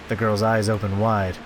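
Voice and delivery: ordinary delivery, nothing marked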